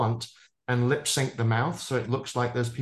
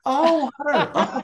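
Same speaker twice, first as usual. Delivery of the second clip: high, slow, laughing "Oh, water" from a flight attendant who finally gets it; mock the attendant's dawning understanding; a hammed-up flight attendant finally catching on; 6 dB louder, much slower than usual. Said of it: "the flight attendant will go".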